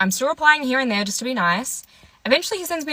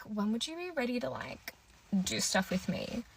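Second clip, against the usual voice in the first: much softer sheepish question; awkward mumble; 15 dB quieter than usual. Retold or imitated. Date asking about sex, very soft and sheepish. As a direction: mumble it, embarrassed. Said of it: "he was like".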